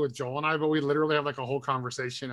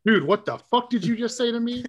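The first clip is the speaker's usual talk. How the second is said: higher, indignant mock outrage; pissed-off retort; 8 semitones higher, 4 dB louder than usual. Retelling his reply to accent accusers, mock indignant. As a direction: act offended, voice jumping up. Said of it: "the only time I would ever get pissed".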